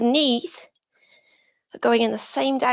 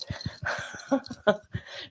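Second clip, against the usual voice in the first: quiet breathy giggle, real; soft chuckle; 8 dB quieter than usual. Real, not acted. Teacher amused at the class wiggling hips, chuckling softly.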